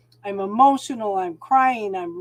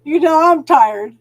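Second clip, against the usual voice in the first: much louder and higher, exclaiming; loud complaint; 6 semitones higher, 12 dB louder than usual. Real, not acted. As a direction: announce your tiredness to everyone. Declares her exhaustion.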